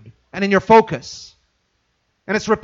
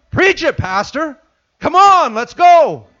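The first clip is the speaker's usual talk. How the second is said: higher, louder eager cheer; excited amen; 8 semitones higher, 6 dB louder, slower than usual. Retold or imitated. Changed churchgoer cheering the pastor, excited and louder. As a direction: fire up the preacher. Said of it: "Wait a minute".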